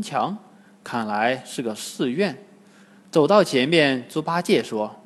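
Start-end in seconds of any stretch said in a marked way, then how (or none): none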